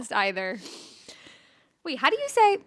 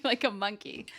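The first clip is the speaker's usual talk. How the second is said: normal talking voice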